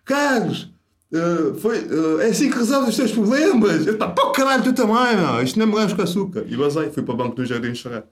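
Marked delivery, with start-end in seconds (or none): 0.0-3.9 much higher, slow, put-on sneering voice; mocking instructor. Instructor taunts student who walked out, high mocking voice. Instructor mocks him quitting exam. 3.9-6.4 much higher, laughing, louder protest; laughing outburst. Retold or imitated. Student snaps back at the instructor, high laughing protest. His own retold outburst.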